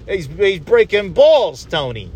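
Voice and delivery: lightly voiced Sopranos-style wiseguy; a hint of drawn-out mob-guy banter; Italian dad. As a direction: stretch it out like a ribbing mobster. Mobster-style wisecrack after Italian dad talk.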